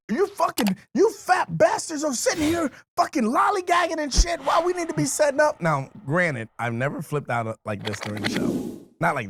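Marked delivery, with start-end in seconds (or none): 0.1-5.6 retold angry shout. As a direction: tear into the lazy team. Yelling at team lollygagging.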